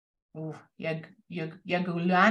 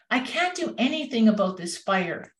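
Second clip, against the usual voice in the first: ordinary delivery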